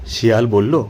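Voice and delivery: ordinary delivery, nothing marked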